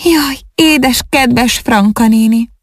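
high, slow, tearful wail; a touch of weeping for her aunt; mild sobbing lament. Acted. Ágnes mourns her lost aunt, slow high tearful cry. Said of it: "zokogásba fakadt".

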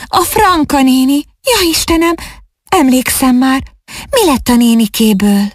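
startled by a dreadful memory, pitched well up; frightened exclamation. A narrator's reading. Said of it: "kiáltott fel ijedten".

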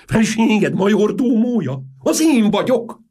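very high, loud, slow indignant cry; outraged shout. A narrator's reading. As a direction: protest at the top of your voice. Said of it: "kiáltotta Antas".

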